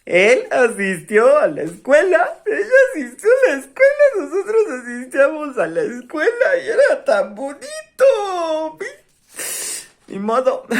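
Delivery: a mock weepy whine that ends in a fake sob; mock nostalgia. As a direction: pretend to sob over the good old school days, half laughing. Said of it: "porque ahorita andamos nostálgicos".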